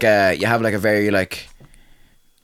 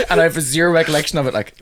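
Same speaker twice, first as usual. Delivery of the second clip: ordinary talk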